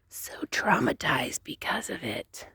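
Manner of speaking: hushed, voiceless whisper; traumatized whisper. Real, not acted. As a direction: whisper it, shaken by what you saw. Confessing how shaken she is.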